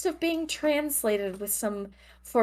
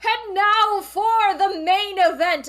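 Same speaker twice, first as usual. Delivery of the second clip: ringmaster voice; 11 semitones higher, 4 dB louder than usual. Acted. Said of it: "he's like".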